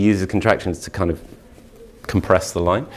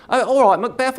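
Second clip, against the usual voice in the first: pitched way up, hammy stage voice; mocking a pompous actor. Teacher mocking how actors say Shakespeare, high hammy voice. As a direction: send up the overblown Shakespeare actor. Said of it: "that's what they all sound like".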